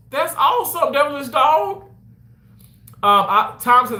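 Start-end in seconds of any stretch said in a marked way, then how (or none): 0.1-1.8 high, excited congratulation; delighted. Real, not acted. Streamer praises a viewer's news, delighted. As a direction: cheer on a friend's good news.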